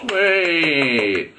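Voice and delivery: high, loud, drawn-out whoop; long falling banquet cheer; crowd cheer. A narrator's reading. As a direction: cheer the speaker on. Crowd applauds the speech.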